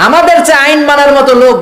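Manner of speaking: rousing preacher. Acted. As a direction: proclaim it to the crowd. Preaching at full force.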